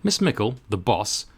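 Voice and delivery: ordinary delivery, nothing marked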